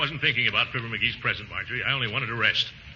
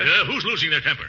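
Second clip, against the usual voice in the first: testy comeback, a touch raised; losing his temper; 3 semitones higher than usual. Acted. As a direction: deny it with rising irritation. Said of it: "don't lose your temper".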